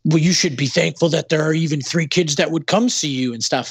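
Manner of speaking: mocking the scolding fans. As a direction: lightly mimic a self-righteous fan. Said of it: "people would be like".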